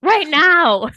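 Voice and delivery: loud, high outraged yell at the TV; retold yelling. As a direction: re-enact yelling at the screen. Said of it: "I was literally yelling at the T V".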